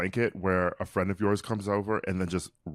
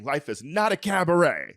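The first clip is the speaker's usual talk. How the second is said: high mock yell, a bit louder; imitated scream; 7 semitones higher, 4 dB louder than usual. Host imitating reality star screaming at Luann, high mock scream. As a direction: yell it as a mimicked TV meltdown. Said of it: "that's what she's screaming".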